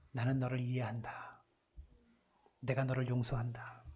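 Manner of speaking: soft, low, hushed forgiveness; tender whisper. Acted. Jesus looking at Peter, whispering tender forgiveness. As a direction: whisper it like a gentle embrace.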